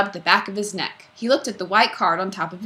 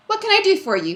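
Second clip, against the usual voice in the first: breezy cocky; 13 semitones higher, 5 dB louder than usual. Acted.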